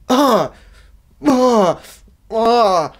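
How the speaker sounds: loud high groans of disgust; disgusted ugh. Real, not acted. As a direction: groan in revulsion.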